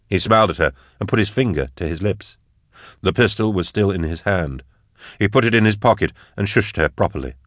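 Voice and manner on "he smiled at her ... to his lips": ordinary delivery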